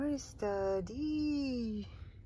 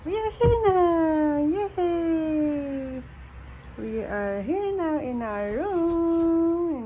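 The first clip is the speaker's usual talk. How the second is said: excited cheer; 7 semitones higher, 6 dB louder, much slower than usual. Real, not acted. Arriving at the hotel room.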